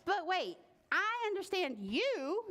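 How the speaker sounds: high, puzzled objection; boy objecting. Acted. Boy pointing out to a tree, high-pitched objection. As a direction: pitch up as if catching a flaw.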